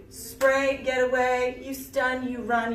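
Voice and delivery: normal talking voice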